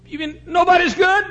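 high, loud retold disbelief; incredulous. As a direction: blurt it out as a shocked question. Woman reacts in disbelief.

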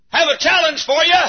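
high-pitched preacher's challenge; ringing high challenge in the world's voice; the world calling out. Acted. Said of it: "The world says".

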